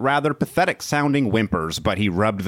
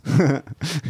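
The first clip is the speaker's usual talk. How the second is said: burst of laughter; 6 semitones higher than usual. Real, not acted. Cracks up mid-reading.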